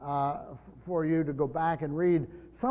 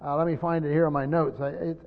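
ordinary talk

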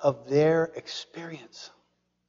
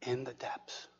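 much softer, unvoiced, falling; whisper; 6 semitones lower, 18 dB quieter than usual. Preacher closing with a whispered refrain to the congregation. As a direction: let it fade to a whisper.

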